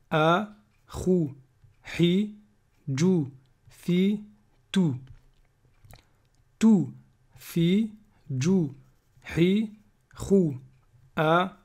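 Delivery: each syllable said alone with a short pause; syllable drill reading. Shown as a demonstration. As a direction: read the drill slowly and clearly, one syllable at a time. Reads Arabic syllable drill aloud.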